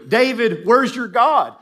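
loud, high taunt; mocking. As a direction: jeer it at a man who is down. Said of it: "it's a mockery".

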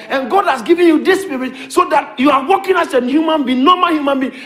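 loud, high preaching cry; shouted preaching. Real, not acted. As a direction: preach it out at full force. Preaching climax.

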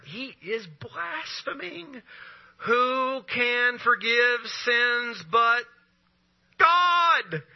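mock indignant religious leader; scandalised scribe. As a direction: drag it out in pious shock, then explode on God. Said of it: "scribes were sitting there questioning".

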